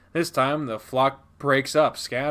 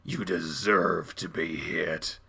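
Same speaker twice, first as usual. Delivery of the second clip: low, slow, softer menace; cold contempt; 8 semitones lower, 7 dB quieter, slower than usual. Acted. Dad answering frightened child, low cold contempt. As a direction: drop low and say it slowly.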